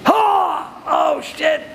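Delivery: high, drawn-out cry of dismay; pained, high-pitched "oh"; shocked outcry. Acted. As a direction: cry out as the pain suddenly hits. Acts out the pain hitting.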